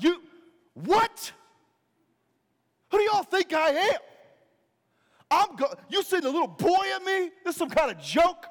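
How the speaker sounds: high, loud, indignant outburst; ticked-off Goliath. Acted. Goliath insulted by a boy challenger, angry. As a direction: explode in hammed-up outrage. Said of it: "he gets ticked".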